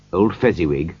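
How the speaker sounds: usual speaking voice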